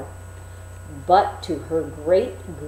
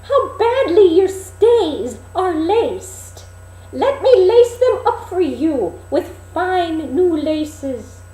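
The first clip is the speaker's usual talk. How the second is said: put-on a disguised queen hawking like an old crone; peddler woman crying wares; 10 semitones higher, 5 dB louder than usual. Queen as old peddler calling at the dwarfs' door. Peddler fusses over laces.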